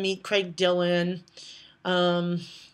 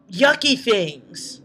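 ordinary delivery

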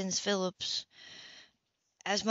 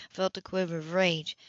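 plain reading voice

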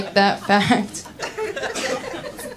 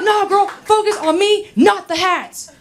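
high, exasperated imitation of a lover; worked-up demand; 10 semitones higher than usual. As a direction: plead indignantly, hammed up. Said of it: "he was all worked up".

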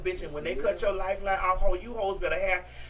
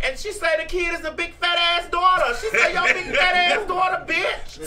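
imitating the mother; 7 semitones higher, 4 dB louder than usual. Quoting the mother in the clip.

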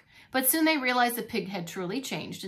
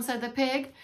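usual speaking voice

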